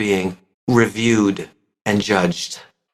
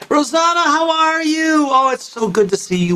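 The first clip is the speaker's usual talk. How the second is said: excited greeting; 14 semitones higher than usual. Acted. Greeting a friend over coffee.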